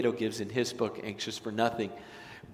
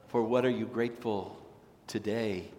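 usual speaking voice

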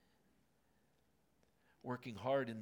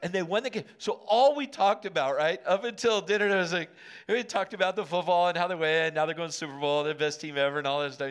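excited; 5 semitones higher, 13 dB louder, faster than usual. Real, not acted. Excited football recap.

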